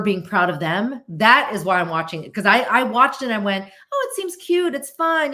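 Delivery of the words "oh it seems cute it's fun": high, airy quoted reaction; light first reaction. Retold or imitated. Viewer recalling first thoughts on a show, lightly approving. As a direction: lift it high, as a mock first impression.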